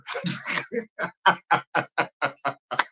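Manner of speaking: long, trailing run of chuckles; long laugh. Real, not acted. Host laughing at their own mock outrage, long chuckle. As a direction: break into extended laughter. Laughs after claiming boiling blood.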